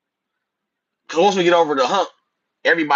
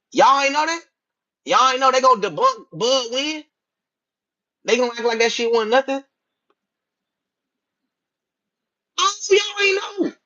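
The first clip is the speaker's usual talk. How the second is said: mock surprise; 10 semitones higher than usual.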